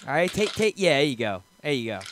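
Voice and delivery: low, soft talking-down; mock soothing. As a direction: hush a worked-up friend, voice dropping away. Calming down his worked-up co-host.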